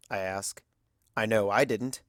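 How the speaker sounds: usual speaking voice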